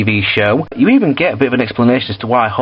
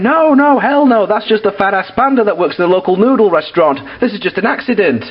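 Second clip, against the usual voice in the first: higher mock outraged protest; protesting crowd; 8 semitones higher than usual. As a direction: squeal it like the crowd can't believe it. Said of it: "everyone's going".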